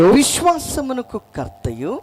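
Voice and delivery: loud, slow, drawn-out scripture call; proclaimed verse. Real, not acted. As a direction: raise the pitch and stretch each word out. Echoes the verse for congregation.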